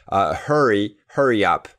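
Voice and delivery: ordinary delivery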